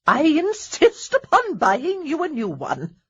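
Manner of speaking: slow, high dowager declaration; grand announcement. A narrator's reading. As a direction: announce it to the room. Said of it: "Lady Danbury announced".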